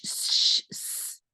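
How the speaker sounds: breathed "ch" then a hissing "s"; unvoiced "ch" and "s" demo; ch and s sounds. Shown as a demonstration. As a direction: show the hissing sounds clearly. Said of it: "un son qui fait".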